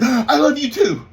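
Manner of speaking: loud, high, hurried reply after a gasp; imitating a kid cutting dad off; panicked blurt. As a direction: blurt it out to head off embarrassment. Son blurts it before dad can yell.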